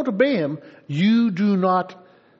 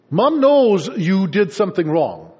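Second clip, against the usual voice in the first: ordinary talk, nothing marked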